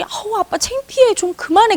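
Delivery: lightly acted high-pitched embarrassment; embarrassed daughters. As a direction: imitate a daughter begging dad to stop. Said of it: "그러면 자기 딸들이".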